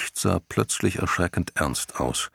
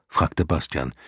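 ordinary delivery

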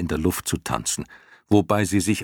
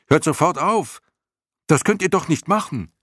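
high-pitched, urgent cry; protesting shout; 11 semitones higher, 4 dB louder than usual. A narrator's reading. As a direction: yell up at them to stop. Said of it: "schrie er hinauf". (empört hinaufgeschrien, mit hoher Stimme)

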